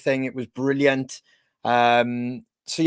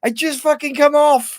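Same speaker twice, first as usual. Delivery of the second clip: shout; 12 semitones higher, 7 dB louder than usual. Retold or imitated. Jimmy's retort, retold.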